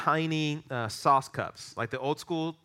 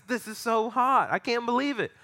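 high-pitched, imitated complaint; mock distress; 9 semitones higher, 3 dB louder than usual. Imitating English friend burnt by hot sauce, mock distress. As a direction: play the overwhelmed friend, pitched way up.

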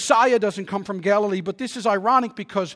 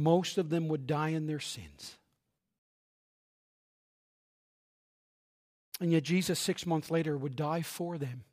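much softer, hushed preaching; solemn soft close; 5 semitones lower, 7 dB quieter than usual. Acted. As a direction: bring the sermon down to a tender hush.

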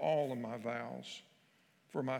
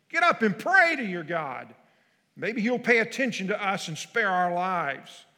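shouting captain; 6 semitones higher, 12 dB louder than usual. Acted. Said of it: "he shouted".

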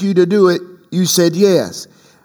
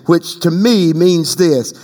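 normal talking voice

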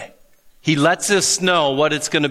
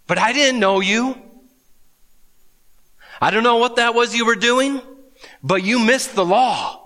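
Jesus rejecting them; 6 semitones higher than usual. Retold or imitated. Jesus tells religious people he never knew them, in high earnest rebuke. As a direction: tell them they missed it. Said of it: "He's saying".

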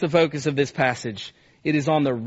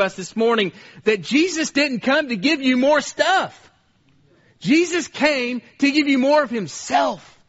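raised, driving sermon voice; preaching at full force; 4 semitones higher than usual. Real, not acted. Preacher driving the point home to the church, raised and insistent. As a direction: press each contrast home to the congregation.

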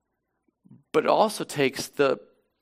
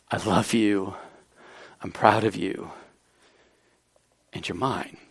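low, hushed and intimate; tender love; 9 semitones lower than usual. Retold or imitated. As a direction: say it gently, like a father to his child alone. Jesus's love restated intimately.

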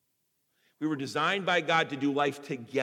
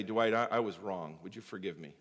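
soft, lower, humbled voice; humble apology; 8 semitones lower, 5 dB quieter than usual. Retold or imitated. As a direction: swallow your pride and ask quietly. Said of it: "humble myself".

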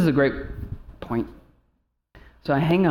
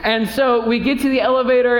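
ordinary delivery

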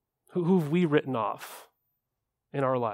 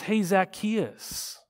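tender call; 3 semitones higher, 4 dB quieter, much slower than usual. Retold or imitated. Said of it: "He says".

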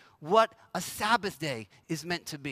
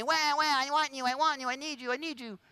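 put-on higher, whining baby cry voice; whiny phone baby; 5 semitones higher than usual. Phone nagging its owner like a crying baby, higher whine. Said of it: "phone ding and say".

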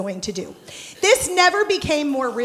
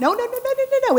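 high, rattled-off string of noes; rapid-fire denial; 11 semitones higher, much faster than usual. Acted. Speaker correcting the audience's guess, rapid high denial. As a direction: shoot the idea down in a quick high volley. Cutting off her hopeful supposition.

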